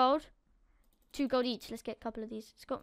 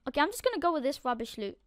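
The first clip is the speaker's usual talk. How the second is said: normal talking voice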